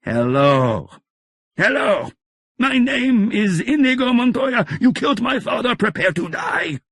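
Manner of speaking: rising to a high, loud shout; stronger, shouting. Acted. Inigo shouting his vow at the Count, building to a yell. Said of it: "Stronger now".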